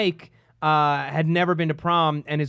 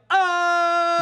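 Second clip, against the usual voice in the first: long, high-pitched "oh" call; mock schoolyard fight holler; imitated yell; 11 semitones higher than usual. Middle schooler calling out a fight, imitated yell. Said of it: "someone would just yell".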